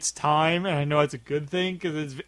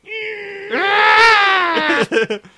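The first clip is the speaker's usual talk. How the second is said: long high shouted wail; high wailing cry; 7 dB louder than usual. Acted. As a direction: wail high and loud as you fly off. Cartoon villain wail.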